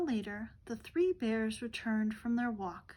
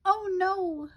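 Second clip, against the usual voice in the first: dismayed oh no; 9 semitones higher, 7 dB louder than usual. Acted. Goldilocks dismayed by chair.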